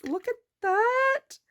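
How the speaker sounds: high, slow, tender coo. Real, not acted. Cooing over tiny toy.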